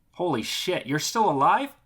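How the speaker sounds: shocked delight at seeing a friend alive; surprised. Acted. Trucker greets a friend he thought was dead, loud amazed.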